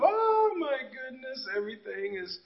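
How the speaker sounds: soft, swooning sigh of new love; acted dreamy bliss, hushed and slowing; mock lovestruck. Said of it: "when you first fall in love".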